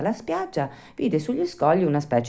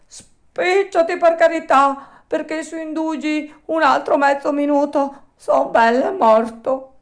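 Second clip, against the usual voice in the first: urgent pleading; 12 semitones higher, 6 dB louder than usual. A narrator's reading. Alidoro urging Pinocchio to hurry, high and desperate. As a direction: beg him to hurry before you drown. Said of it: "A quelle grida strazianti".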